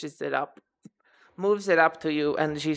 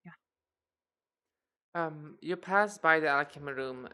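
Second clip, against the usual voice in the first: usual speaking voice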